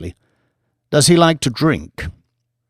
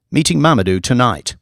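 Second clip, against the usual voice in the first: ordinary delivery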